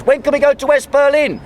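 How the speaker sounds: cheeky question from the crowd. Retold or imitated. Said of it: "one person put up their hand and goes".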